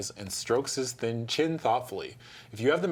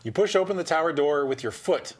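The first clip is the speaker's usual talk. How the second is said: usual speaking voice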